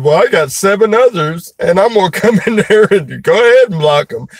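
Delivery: high-pitched mock threat; mock mad. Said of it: "He got mad".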